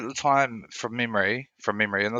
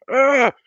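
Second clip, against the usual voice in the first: high, loud groan of dismay; retold groan of dismay; 8 dB louder than usual. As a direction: let out a pained ugh. Retells panic calling her mum.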